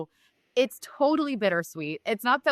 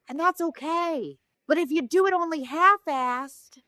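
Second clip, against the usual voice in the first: high, airy diva voice throughout; higher-pitched mock Coolidge telling the story; Jennifer Coolidge voice; 8 semitones higher than usual. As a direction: tell it as Jennifer Coolidge would, dreamily. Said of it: "through the voice of Jennifer Coolidge".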